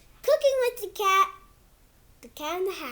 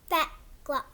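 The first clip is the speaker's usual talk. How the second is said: put-on reading to kids; 3 dB louder than usual. Kid reading his book to viewers in storybook voice. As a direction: read it like a bedtime book for little ones. Reads picture book aloud.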